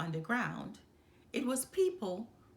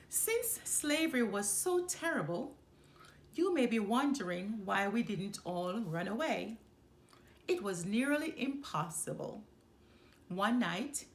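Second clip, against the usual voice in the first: ordinary delivery, nothing marked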